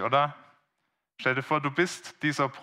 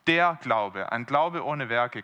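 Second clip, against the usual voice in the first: ordinary delivery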